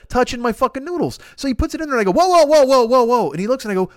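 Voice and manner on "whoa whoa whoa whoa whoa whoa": loud repeated whoa, rising alarm; alarmed shout. Retold or imitated. Diner shouting whoa at the waiter mixing his food. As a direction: stop him before he ruins the noodles. Stopping the waiter mid-action.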